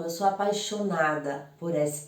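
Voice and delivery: ordinary talk, nothing marked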